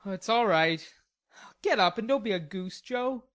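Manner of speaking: put-on offhand young man's brush-off; cavalier. Laurie waving away Jo's apology, cavalier. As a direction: toss it off lightly. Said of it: "was the cavalier reply".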